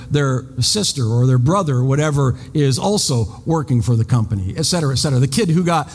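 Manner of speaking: ordinary delivery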